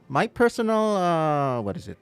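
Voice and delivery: ordinary talk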